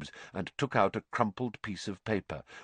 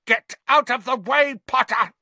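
high, loud angry yell; bellowed order, pitched way up; furious shout; 8 dB louder than usual. A narrator's reading. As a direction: shout it at someone blocking your way. Villain shouting at Potter.

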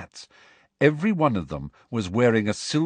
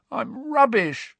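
slow, high strained croak; slower than usual. A narrator's reading. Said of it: "croaked Ron".

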